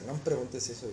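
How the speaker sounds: low, soft retold refusal; taken aback. Woman staring at him after his question, low and reluctant. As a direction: drop low and quiet, imitating her reluctance. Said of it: "se me queda viendo".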